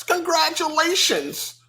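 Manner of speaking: lightly mocking canned reply; robotic response. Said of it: "you want the robotic response".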